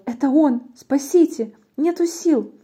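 high acted plea for help; panicked cry. Fox sees the Gruffalo, panicked high cry. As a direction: cry out in mock terror.

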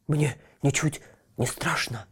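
шёпотом, с тяжёлым дыханием; храбрится, едва переводя дух; тяжело дыша. A narrator's reading. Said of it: "тяжело дыша". (пёс Тило храбрится, тяжело дыша шёпотом)

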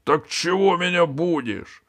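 ordinary delivery, nothing marked

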